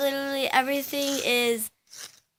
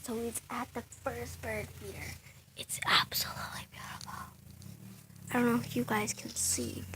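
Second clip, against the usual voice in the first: hushed whisper near the birds; 14 dB quieter than usual. Real, not acted. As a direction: whisper so the bird stays. Hushed so bird stays.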